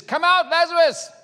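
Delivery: very high, loud call; full-voiced summons, pitch soaring; commanding shout. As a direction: shout it to raise the dead. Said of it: "Jesus says".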